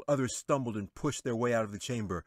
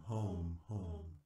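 "home," much softer, like a far-off echo; 2 semitones lower, 9 dB quieter than usual. A narrator's reading. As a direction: let "home," fade away as an echo in a hall. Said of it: "echoed throughout the chamber".

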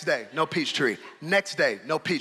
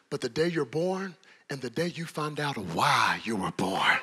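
normal talking voice

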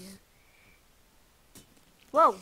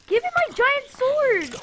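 loud, much higher, slow yell; desperate yell; 12 semitones higher, 11 dB louder than usual. Real, not acted. Gamer yelling for his lost sword, loud and high.

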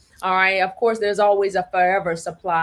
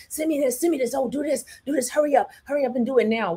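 higher, ending very fast and pushy; pushy narcissist; 5 semitones higher, faster than usual. Retold or imitated. A narcissist rushing a victim, higher pushy hurry. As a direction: mimic someone rushing you. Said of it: "Watch when people rush you".

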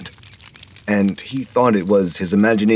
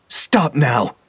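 crying out; 7 semitones higher than usual. A narrator's reading. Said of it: "Tasha cried".

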